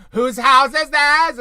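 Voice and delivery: loud, high, drawn-out impersonation; imitated Drew shout. Host retelling Drew's catchphrase, exaggerated high shout. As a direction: holler it slowly, mocking a show coach. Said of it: "when Drew was like".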